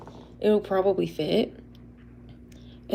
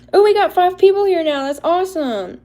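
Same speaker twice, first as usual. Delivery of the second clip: high, loud burst of excitement; excited delight; 9 semitones higher, 8 dB louder than usual. Real, not acted. Seeing more viewers join, thrilled outburst. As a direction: exclaim it high, then ease down.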